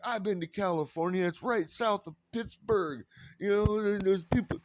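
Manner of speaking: high, quick airheaded mock voice; high mock voice of the clueless woman; clueless gushing woman. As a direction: play her like a confident airhead. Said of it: "She's like".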